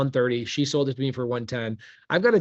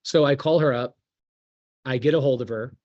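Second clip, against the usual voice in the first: ordinary delivery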